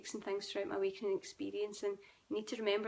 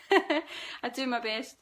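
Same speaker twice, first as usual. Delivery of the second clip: laughing, slow and louder, I do my best; laughing apology; 3 semitones higher, 8 dB louder, much slower than usual. Real, not acted. Sorry, Scottish accent, laughs.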